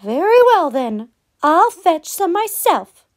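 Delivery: a resigned little hen. A narrator's reading. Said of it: "said Little Red Hen".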